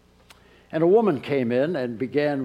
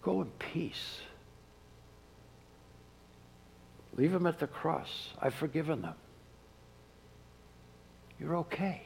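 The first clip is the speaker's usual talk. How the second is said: tender absolution; 3 semitones higher, 10 dB quieter than usual. Acted. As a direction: release them gently from their guilt. Jesus forgiving listener, softly.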